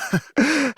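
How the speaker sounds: short, high laugh; light laugh. Acted. Martial artist musing on her life, light laugh. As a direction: let out a brief, high laugh. Character chuckles before reflecting.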